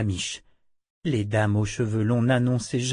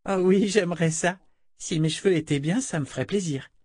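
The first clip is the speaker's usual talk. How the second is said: put-on higher-pitched, pleased child voice; happier little girl; 6 semitones higher than usual. A narrator's reading. Girl welcoming the offer of a haircut, pleased. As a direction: answer eagerly in a child's higher register. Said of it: "mais plus joyeusement".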